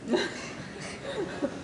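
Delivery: a soft, unvoiced high laugh; shocked by news of a second sister, laughing; breathless high laugh. Real, not acted.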